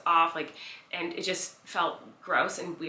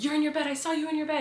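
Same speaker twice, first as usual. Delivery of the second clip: higher, startled insisting; alarmed roommate; 8 semitones higher, faster than usual. Retold or imitated. Roommate seeing the narrator in bed, alarmed. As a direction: blurt it out in alarm.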